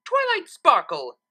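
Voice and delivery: loud, high, drawn-out call of a name; cried out. A narrator's reading. Trixie calling Twilight from outside the door, loud cry. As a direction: shout her name up at the house. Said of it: "she cried".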